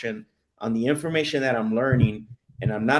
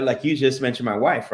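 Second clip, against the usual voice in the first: normal talking voice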